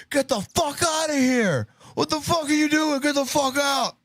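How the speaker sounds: high, urgent mock yell; imitated panicked shout. Host imitating man in the clip ordering people out, high shout. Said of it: "he's like".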